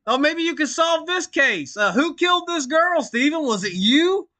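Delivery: high, sneering mock detective; mock interrogation. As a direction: play the smug detective, mocking him.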